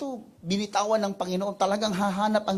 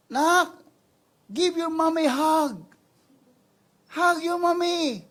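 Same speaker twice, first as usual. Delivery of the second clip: high, slow coaxing mother's plea; mother coaxing child; 13 semitones higher, 8 dB louder, slower than usual. Acted. Mother coaxing her child away from the lion, slow high plea. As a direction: gently lure the child back, slow and high. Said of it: "sabi niya".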